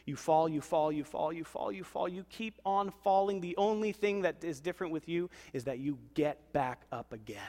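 ordinary talk, nothing marked

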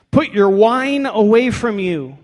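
theatrical priestly scolding; mock stern command. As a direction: order her sternly, hammed up. Continues the priest's harsh rebuke.

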